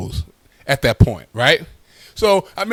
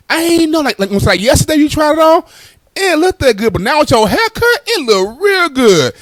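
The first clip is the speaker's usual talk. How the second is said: high, loud sweet-talking mother; butter him up like a mom; coaxing mom; 8 semitones higher, 3 dB louder than usual. Retold or imitated. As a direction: mom coaxing her mad son in a high voice. Mom softening the blow.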